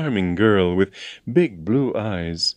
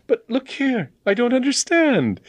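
much higher, startled protest; baffled. A narrator's reading. James baffled by the agent's change of heart, startled. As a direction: stammer in disbelief.

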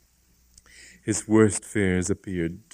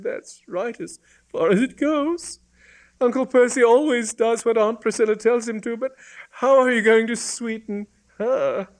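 put-on high-pitched girl's voice; high, girlish and querying; doubtful young woman; 14 semitones higher, 3 dB louder than usual. A narrator's reading. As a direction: question the plan in a high female voice. Voices the girl questioning.

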